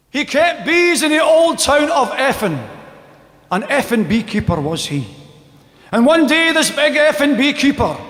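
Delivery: high, ringing declamation with falling cadences; rhythmic, loud comic poem delivery; comic verse recital. Acted. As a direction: declaim it like a mock-heroic ballad. Performs comic verse about beekeeper.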